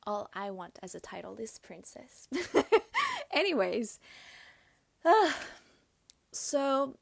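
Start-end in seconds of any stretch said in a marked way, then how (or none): 2.3-3.3 high, loud burst of laughter; loud high-pitched cackle. Real, not acted. Laughs at own princess joke. 5.0-5.6 loud, high exhaled sigh; big audible sigh. Real, not acted. Resets before going on.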